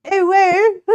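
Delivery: high, loud mock British child; British child impression. Streamer mocking own French accent attempt, British child voice. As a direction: chirp it loudly as a pretend British child, laughing. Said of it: "Proceeds to be a British child".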